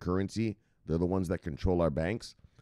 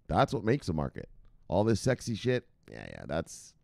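ordinary talk

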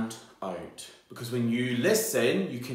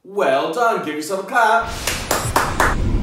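excited praise; 7 semitones higher, 8 dB louder, slower than usual. Real, not acted. Praising children in a lesson.

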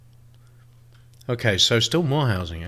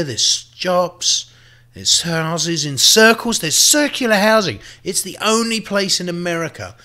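higher and louder, proud salesman pitch; boastful hype; 7 semitones higher, 4 dB louder than usual. Acted. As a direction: sell the town like a proud mayor. Boasting about his city.

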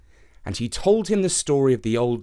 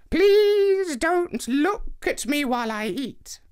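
put-on much higher, slow old woman voice; old lady; 14 semitones higher, slower than usual. A narrator's reading. Old lady asking Anansi not to watch, high and slow. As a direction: ask gently in a thin old voice. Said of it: "said the old lady".

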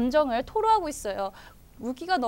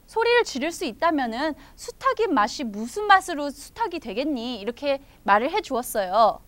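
ordinary delivery, nothing marked

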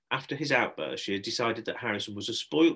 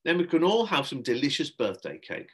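slightly higher, louder jollying voice; breezy, carrying on regardless; forced cheer; 5 semitones higher, 5 dB louder than usual. Acted. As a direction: coax children with a treat. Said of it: "acting as if nothing had happened".

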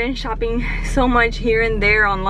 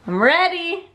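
I'm ready lifted higher, eager; 6 semitones higher, 4 dB louder than usual. Real, not acted. Repeating she's ready to decorate, eager and higher. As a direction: sing the second ready up bright.

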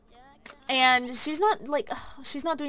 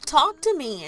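pleading shout; 5 semitones higher, 6 dB louder than usual. Acted. Son pleading with ignoring mother.

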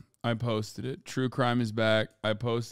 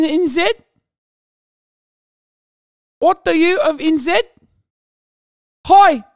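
put-on much higher, loud New Zealand accent with shouted hoi; mock Kiwi accent; 11 dB louder than usual. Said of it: "He said".

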